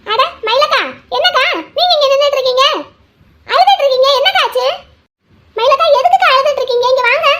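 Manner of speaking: surprised concern. Acted. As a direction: call out in alarm to a crying friend. Recognises friend, finds her crying. (பதறிப்போய் அக்கறையோடு விசாரிப்பது)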